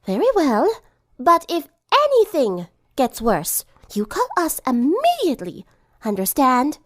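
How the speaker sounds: high, prim instruction; firm elegant lady. Acted. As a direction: lay down the rule gracefully but firmly.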